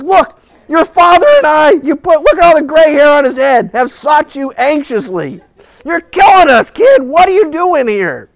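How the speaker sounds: loud, high, hammed-up tirade; exasperated mother. Mother berating her found son, acted exasperation. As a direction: scold the boy at the top of the voice.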